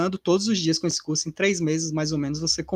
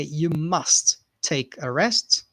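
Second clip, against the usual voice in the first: very slow model sentence; much slower than usual. Shown as a demonstration. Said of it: "slow repeat model".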